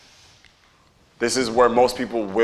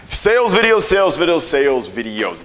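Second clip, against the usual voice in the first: excited announcement; 5 semitones higher, 4 dB louder than usual. Acted. Announcing the topic, fading.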